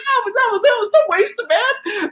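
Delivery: very high, laughing plea; pleading store owner. Retold or imitated. Record store owner begging [name], very high laughing plea. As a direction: squeak it out between laughs. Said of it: "he's like".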